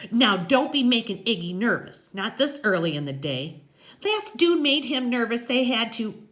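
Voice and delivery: high, loud nagging woman; chattering biker's woman; Loretta, warning. A narrator's reading. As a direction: caution the kids in a high character voice. Voices Loretta in story.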